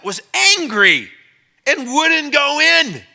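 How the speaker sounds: "angry" stretched slow and loud, high; slow, loud, drawn-out emphasis; slow emphatic. Acted. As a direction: drag "was angry and wouldn't go in" out for effect. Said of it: "check this out".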